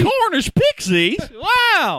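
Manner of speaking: high, loud Southern holler; Alabama Southern accent. Acted. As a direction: shout it with a drawn-out Alabama twang. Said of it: "like, Southern, like, Alabama".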